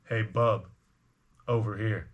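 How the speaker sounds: low, lightly rasped gruff call; raspy voice. Acted. Seedy minotaur hails the farmer from beside the house, raspy. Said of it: "kinda like a raspy voice".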